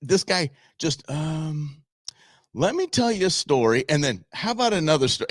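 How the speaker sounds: high-pitched imitation of a long-winded speaker; rambling speaker. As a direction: launch into yet another story. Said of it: "he said, he goes".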